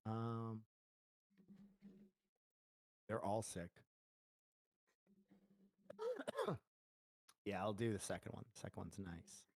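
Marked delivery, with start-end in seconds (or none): 5.9-6.6 sharp real cough. Real, not acted. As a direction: cough once, hard. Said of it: "Sorry, I'm coughing".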